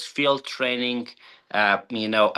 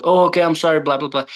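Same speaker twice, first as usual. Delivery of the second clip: sheepish apology; 5 semitones higher, 5 dB louder, faster than usual. Retold or imitated. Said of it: "He's like".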